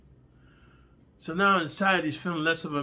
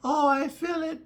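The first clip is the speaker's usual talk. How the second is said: high falsetto, usual volume; mock woman's voice. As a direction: put on a high-pitched woman's voice. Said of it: "and then she said".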